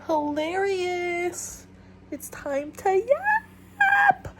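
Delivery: high, slow, drawn-out sing-song announcement; silly intro voice. Pretend. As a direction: stretch it out like a goofy show opener.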